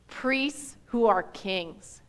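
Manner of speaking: Priests who are kings read very slow and soft, each word apart; slow and quiet. Shown as a demonstration. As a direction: let every word sink in slowly. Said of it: "I told you we were gonna go real slow".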